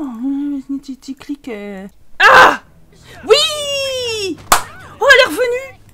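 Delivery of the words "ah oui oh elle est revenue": high loud cheer of delight; excited cheer. Real, not acted. Gamer cheers as the woman comes back, high and loud. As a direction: shout for joy, much higher. Character returns for fight.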